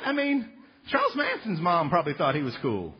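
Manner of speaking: ordinary talk, nothing marked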